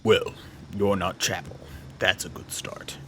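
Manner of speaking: low, soft put-on voice; deep-voiced game character. Wary contact sizing up strangers, deep and low. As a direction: keep it deep and quiet, trailing into breath.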